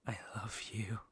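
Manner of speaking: soft, breathless whisper of love; whispered confession. Acted. Villain confessing love to captive princess, hushed whisper. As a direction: whisper it like a secret.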